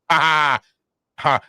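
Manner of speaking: hammed-up ha ha ha, loud; mock gloating laugh. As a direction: cackle at those who stayed home. Gloating over people who miss out.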